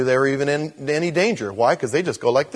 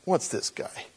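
breathy, annoyed under-the-breath remark; a clerk bothered by a customer, annoyed murmur; irritated aside; 6 semitones higher, 6 dB quieter, slower than usual. Retold or imitated. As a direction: grumble it to yourself, put out. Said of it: "he irritated me immediately".

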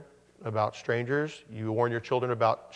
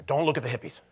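stern warning mom voice; a touch of a serious mother hushing a child; faster than usual. Retold or imitated. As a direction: mom correcting her five-year-old, mildly stern.

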